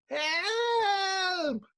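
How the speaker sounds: high, panicked cry for help; terrified cry. Acted. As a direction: scream for help as ground shakes. Earthquake terror in desert.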